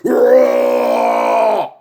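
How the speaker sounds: imitated evil cackle into a deep roar; cackle, then growl low like a monster; clown cackle. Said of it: "And then the clown's like".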